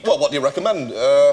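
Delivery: put-on borrowed Northern English accent. Customer asking server after the swap, Northern English. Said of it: "let's try it out then".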